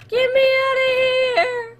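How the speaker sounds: high, drawn-out wail; high-pitched desperate cry; panicked plea. Real, not acted. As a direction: wail it high, stretching the last word. Panicking in horror game.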